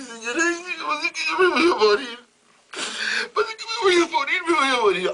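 comic high-pitched blubbering; hammed-up wailing. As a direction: wail it like a hysterical maid. Maid Jenny wailing she'll die.

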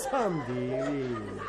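lento y más grave, con risa; entre risas. Real, not acted. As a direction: suspirarlo mientras termina de reírse. Remata riéndose su propio chiste. (comentario risueño y arrastrado)

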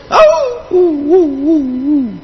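imitated dog's howl, rising and falling; a comic long dog's howl. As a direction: howl like a lazy dog. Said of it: "el perro empieza a aullar".

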